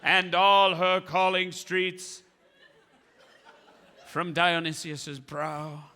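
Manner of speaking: slow, grand poet declaiming with laughs; hammed-up ancient Greek herald, savouring each line; grandiose ode.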